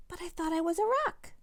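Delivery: put-on high, fast little boy protest; protesting little brother. A narrator's reading. Little brother protesting his new role, high and fast. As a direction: object quickly in a high small voice. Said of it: "says Eduardo".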